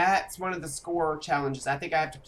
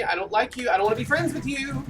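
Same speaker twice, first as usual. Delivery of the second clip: panicked whining; 8 semitones higher, faster than usual. Pretend. Fleeing a Wendigo in panic.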